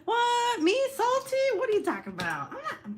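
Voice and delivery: high, loud mock indignation; mock outrage. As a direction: squeak it like you're offended. Denies being salty.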